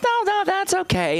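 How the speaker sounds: high, eager no no; mock polite refusal. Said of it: "And she goes".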